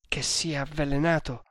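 ordinary delivery

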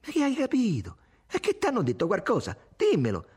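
high-pitched, wounded pleading; heartfelt distress. A narrator's reading. Irene begs Tommaso to explain himself, distressed.

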